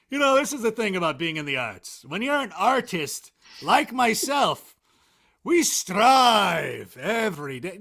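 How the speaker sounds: hammed-up pretentious; mock pretentious artist. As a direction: lay it on thick like a self-important artiste. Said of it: "That sounded so st-, so pretentious".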